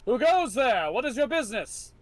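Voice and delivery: loud, high call from the wall; high shouted sentry challenge; guard's challenge. Acted. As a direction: challenge strangers at the gate.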